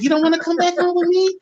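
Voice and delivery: high-pitched mock mom voice; imitating his mother. Retelling mother's playful question.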